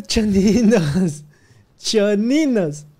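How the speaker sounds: slow stretched sing-song call; drawn-out calling. Real, not acted. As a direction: call the fans slowly, playfully. Llama a su audiencia cantadito. (llamado lento y cantado)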